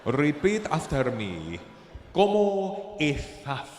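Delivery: slow lisped Spanish teacher; drawn out with a lisp, teaching; lisping Castilian teacher voice. Retold or imitated. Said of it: "how fruity it sounded".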